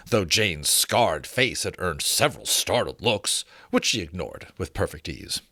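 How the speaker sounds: ordinary delivery, nothing marked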